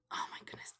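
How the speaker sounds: whispered in awe; awed whisper. Real, not acted. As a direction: breathe it out, starstruck. Awe at Gandalf speech.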